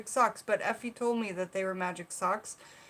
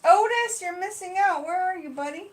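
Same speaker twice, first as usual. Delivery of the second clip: high, loud call to someone missing; 10 semitones higher, 7 dB louder than usual. Real, not acted. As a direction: call out for an absent buddy. Calls out to absent Otis.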